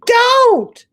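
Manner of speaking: very high, loud cry; scream. Acted. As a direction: shriek in terror. Sophie wants to scream.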